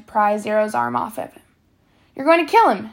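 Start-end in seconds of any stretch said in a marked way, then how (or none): 2.1-2.9 alarmed, higher and louder shout; urgent raised-voice warning. A narrator's reading. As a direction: cry out to stop a choking. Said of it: "shouted Mister Pandansky".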